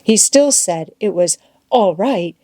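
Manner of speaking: ordinary delivery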